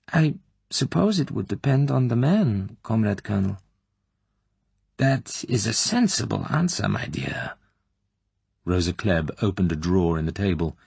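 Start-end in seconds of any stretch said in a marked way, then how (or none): 0.1-3.6 a lightly voiced, higher, hesitant girl; timid young woman. Tatiana answering the colonel cautiously, timidly. 5.0-7.5 insinuating colonel. Acted. Klebb probing the girl's private life, slyly.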